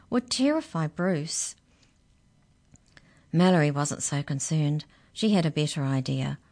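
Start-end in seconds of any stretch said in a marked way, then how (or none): none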